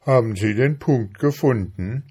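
a touch of calling out; calling over. A narrator's reading. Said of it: "rief der Käfer hinüber".

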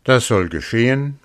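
a wolf with his mouth watering; eager wolf. A narrator's reading. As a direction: jump at the offer. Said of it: "sprach der Wolf".

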